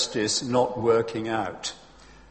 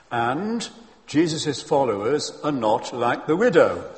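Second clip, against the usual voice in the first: ordinary talk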